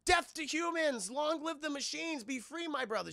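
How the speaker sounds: high-pitched rallying cry; sassy droid. Acted. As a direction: chant it like a droid revolutionary. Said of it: "the sassy droid, the one was like".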